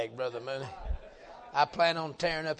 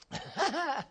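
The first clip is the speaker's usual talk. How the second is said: short high-pitched laugh; quick high chuckle to himself; 10 semitones higher, 3 dB quieter than usual. Real, not acted. Preacher to congregation after his own joke, high laugh.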